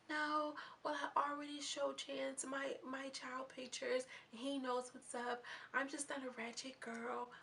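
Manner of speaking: high, soft girlish put-on voice; Believable voice impression. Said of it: "my believable voice is believable".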